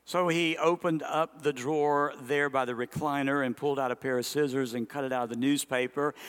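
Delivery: normal talking voice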